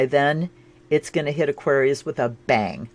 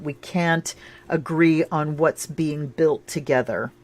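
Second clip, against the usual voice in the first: ordinary delivery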